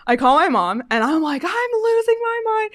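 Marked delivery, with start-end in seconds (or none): 1.4-2.7 mock-distressed cry, pitched way up; retold panic. Telling mom on the phone she's losing it, high mock panic. Retelling frantic call to mom.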